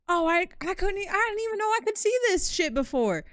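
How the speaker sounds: high, loud, fast amazed outburst; excited shock. Real, not acted. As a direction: blurt it out in sudden disbelief. Sudden discovery in game.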